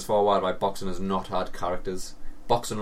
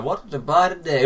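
higher, louder mock musing in the aisle; hammed-up casual shopper voice; 6 semitones higher, 6 dB louder than usual. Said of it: "just like".

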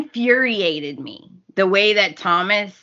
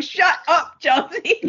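mock shut up; 7 semitones higher, slower than usual. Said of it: "I'm like".

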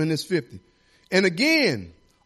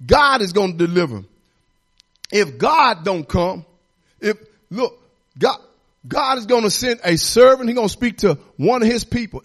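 rising, louder sermon cadence; fervent preaching; 3 semitones higher, 5 dB louder than usual. Acted. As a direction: preach it out to the congregation. Preacher building to full force.

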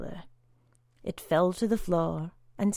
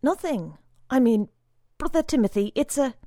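flustered stammer; 5 semitones higher, 6 dB louder, faster than usual. A narrator's reading. Frightened boy stammers excuses.

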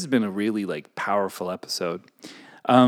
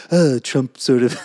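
slow Trump drawl; a bit of Trump; Trump impression; 3 semitones higher, 6 dB louder, slower than usual. Shown as a demonstration. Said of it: "I always kind of default to".

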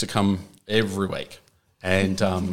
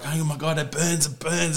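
higher-pitched imitation of a man in pain; mock-panicked burning cry; mock distress; 6 semitones higher than usual. Retelling his burning reaction to friends, mock distress. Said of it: "He's like".

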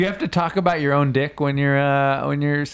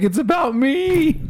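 slow high-pitched woman's voice; girly voice; 12 semitones higher, slower than usual. Retold or imitated. Imitating ex-girlfriend's reaction.

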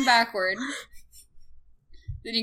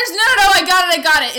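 excited insistence; 8 semitones higher, 9 dB louder, faster than usual. Real, not acted. Eager insistence.

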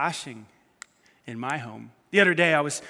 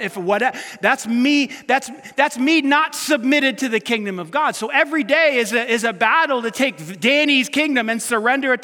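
louder, higher pulpit drive; preaching at full force; 4 semitones higher, 6 dB louder than usual. Real, not acted. Pastor urging surrender to God's kingdom, forceful preaching. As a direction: press the point hard.

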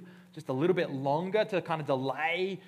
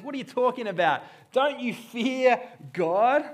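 loud, high, disbelieving rebuke; a criminal rebuking his mocking partner, loud and incredulous; 8 semitones higher, 8 dB louder than usual. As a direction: call him out in outrage. Demas rebukes the other criminal.

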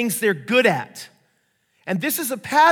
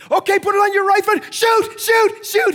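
high, loud shout; dad screaming at his son to shoot, high and loud; excited shouting; 10 dB louder than usual. Retold or imitated. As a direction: yell at him to shoot. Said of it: "The father's like".

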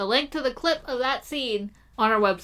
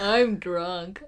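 put-on slow, slurred, drowsy drunk; 3 semitones lower than usual. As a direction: drag it out like you're half asleep. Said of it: "that sounds like you're sleepy".

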